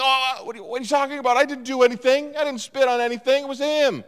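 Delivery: innocent protest. Retold or imitated. Narrator denying the spit prank to the angry pastor, mock innocence. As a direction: play the wide-eyed innocent. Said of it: "And I'm like".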